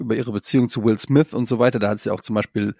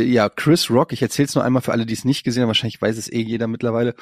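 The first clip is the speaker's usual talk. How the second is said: ordinary talk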